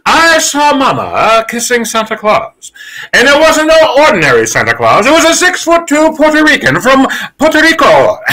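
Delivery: high announcer voice half-singing a carol; sportscaster crooning Christmas carols; Keith Jackson carol. Shown as a demonstration. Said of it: "do him singing Christmas carols".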